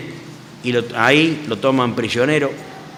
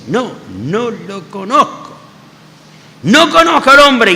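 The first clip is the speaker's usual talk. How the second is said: slow, then a much higher, louder outburst; desperate denial building to a cry; 8 semitones higher, 4 dB louder than usual. Acted. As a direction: Peter cornered, breaking into a shout. Peter's third denial acted.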